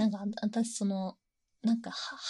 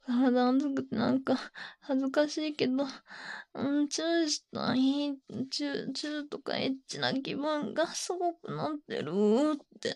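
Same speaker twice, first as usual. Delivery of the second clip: higher, heated and breathless; aroused; 3 semitones higher than usual. Real, not acted. Streamer describing the fantasy while aroused, breathless. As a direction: speak through real arousal, breathy.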